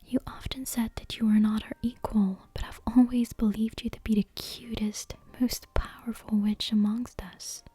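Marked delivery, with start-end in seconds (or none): none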